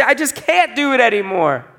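acted plea, high and loud; high, loud, acted giving-up; pleading helplessly. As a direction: cry up to God like a helpless child. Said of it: "we look up and we say".

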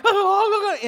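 high, loud panicky "oh, oh, oh"; startled yelps. Retold or imitated. Kid startled by a friend hiding in the bushes, high panicky yelps. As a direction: yelp like you just got ambushed. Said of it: "you're like".

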